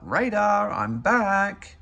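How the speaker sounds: high, loud call across the house. Acted. Big Bird calling out to his teddy bear, loud and high. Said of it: "he called out".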